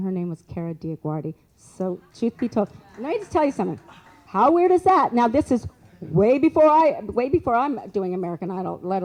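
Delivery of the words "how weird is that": loud, high burst of disbelief; amazed exclamation. Real, not acted. Storyteller marvels to listeners at an odd coincidence, amazed. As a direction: raise pitch and volume in amazement.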